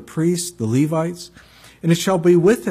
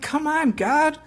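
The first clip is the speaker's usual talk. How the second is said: much higher, mock exasperated plea; imitating his own exasperated self, pitched way up; exasperated cry; 5 semitones higher than usual. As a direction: throw up your hands at God in mock exasperation. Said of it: "I'm like".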